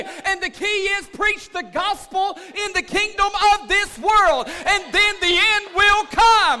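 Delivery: loud, high, ringing sermon delivery; full-force preaching. Real, not acted. Pastor rousing his congregation, preaching at full force. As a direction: preach it out to the whole church.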